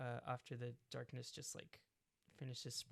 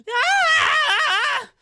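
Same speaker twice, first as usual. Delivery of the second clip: very high loud yelp; 26 dB louder than usual. Acted. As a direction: yelp while dragged away. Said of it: "lets out a yelp".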